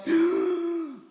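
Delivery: a big, sharp, high gasping inhale; mock the overdone breath-in; loud gasp. Shown as a demonstration.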